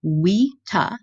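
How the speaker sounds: vita said as wita. Shown as a demonstration. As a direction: say vita with a W, wee-tah. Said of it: "I'm pronouncing a V like a W".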